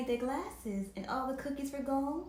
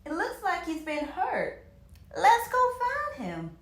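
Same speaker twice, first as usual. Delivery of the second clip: loud, high urgent plea; loud high rushing urgency; 7 semitones higher, 7 dB louder than usual. A narrator's reading. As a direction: push them to go. Urging to rescue hurt dog.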